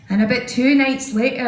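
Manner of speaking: normal talking voice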